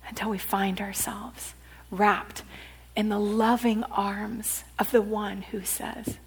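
very soft, low whisper; tender whisper. Acted. Preacher whispering God's words to the congregation, very soft. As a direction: hush it down to almost nothing.

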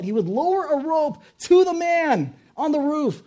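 high, loud, drawn-out call; loudspeaker announcement. Acted. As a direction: mimic a pilot on a loudspeaker. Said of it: "over the loudspeaker, the pilot announced".

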